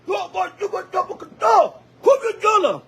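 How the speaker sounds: high, loud, fervent camp shouts; mock worship shouting. Brother shouting fervent praise at camp, loud and high. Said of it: "It's shouting".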